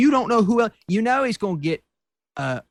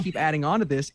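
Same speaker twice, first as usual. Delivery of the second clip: ordinary delivery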